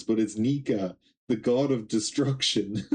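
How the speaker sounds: usual speaking voice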